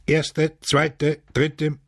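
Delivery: high, crisp roll call; called-out count. A narrator's reading. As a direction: count off the rows as an official call. Guards calling off rows of five.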